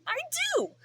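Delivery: playful squeak of pride; mock-proud high squeak; squeaky high. Real, not acted. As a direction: jump way up in pitch. Proud of being annoying.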